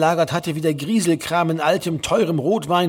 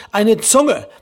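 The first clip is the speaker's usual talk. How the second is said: loud, pitched-up spiteful comeback; venomous retort; 6 semitones higher, 6 dB louder than usual. A narrator's reading. Exasperated son to nagging mother, venomous. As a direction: fire it back with venom. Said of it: "rief Herr Lehmann giftig".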